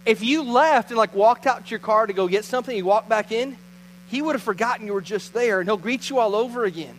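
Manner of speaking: usual speaking voice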